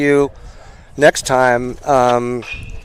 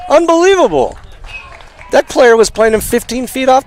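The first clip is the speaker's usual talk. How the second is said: excited; 12 semitones higher, 4 dB louder than usual. Real, not acted. Foul ball call on the line.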